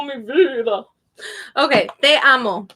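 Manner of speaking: ordinary talk, nothing marked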